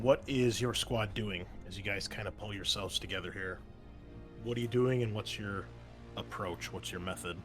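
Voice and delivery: usual speaking voice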